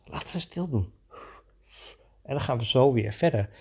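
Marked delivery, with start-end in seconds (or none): 0.0-0.9 much softer, conspiratorial hush; quiet him down as if hiding; soft make-believe hush to the boy. Pretend. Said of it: "ik kan natuurlijk wel zeggen".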